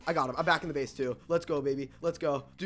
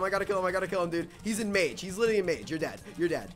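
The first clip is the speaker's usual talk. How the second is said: normal talking voice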